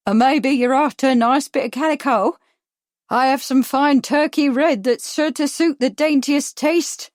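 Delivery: high, loud Cockney sales pitch; Cockney shopkeeper with a touch of Irish; harsh Cockney accent. A narrator's reading. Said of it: "harsh with Cockney accents".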